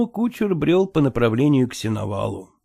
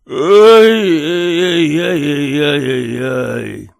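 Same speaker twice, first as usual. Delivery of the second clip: put-on slow, loud drunken lament; drunken wail; 7 dB louder, much slower than usual. Locked-up drunk wails.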